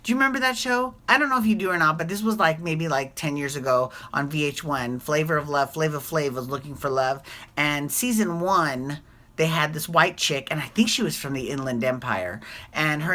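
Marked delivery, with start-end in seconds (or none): none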